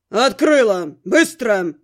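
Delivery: low, loud man's command; gruff, angry demand; growled order. A narrator's reading. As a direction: growl it as an order through the door. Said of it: "из-за двери рыкнули".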